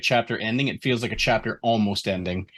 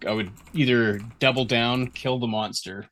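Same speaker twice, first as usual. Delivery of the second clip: usual speaking voice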